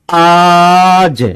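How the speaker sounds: आज held long and loud; emphatic stretched आज. Shown as a demonstration. Teacher drilling the class on today's rate, आज loudly stretched. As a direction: stretch the vowel of आज right out, loudly. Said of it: "जोर से बोलो, आज".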